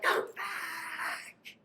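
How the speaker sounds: pleading. Acted. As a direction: breathe the plea up high and hushed. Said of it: "I'm like".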